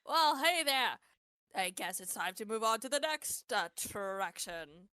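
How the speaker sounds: booming animatronic mascot calling out; loud, high showman hollering to a crowd of kids; Archie voice. Acted. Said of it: "He calls out with an Archie's voice".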